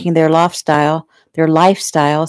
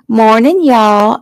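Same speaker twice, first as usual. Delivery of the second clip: mock Southern greeting, a bit higher and louder; 6 semitones higher, 6 dB louder, slower than usual. A narrator's reading. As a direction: parrot her drawl teasingly. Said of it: "Irene mocked".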